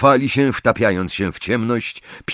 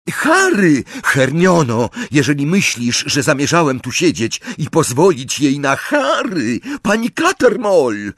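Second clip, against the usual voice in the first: high, raised, insistent voice; urgent calling out; 7 semitones higher, 3 dB louder than usual. A narrator's reading. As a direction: call their names high and urgently. Revealing himself, calling out to others.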